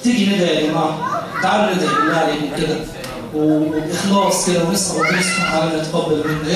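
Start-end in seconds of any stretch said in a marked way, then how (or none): none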